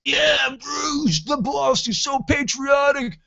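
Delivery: high, loud gushing fan voice; mocking fan. Fans praising [name] as patriotic, high mocking gush. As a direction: squeal like a starstruck fan. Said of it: "I love people that, like".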